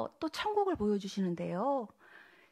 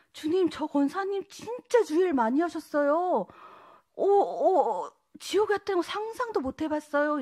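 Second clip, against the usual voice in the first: shocked plea; 5 semitones higher, 5 dB louder than usual. Retold or imitated.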